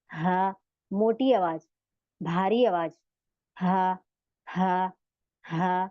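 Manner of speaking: हा said low and heavy from the chest. Shown as a demonstration. Teacher demonstrating the heavy chest हा to students. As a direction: drop हा down into a thick heavy voice. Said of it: "इसमें जैसे मोटी आवाज होती है".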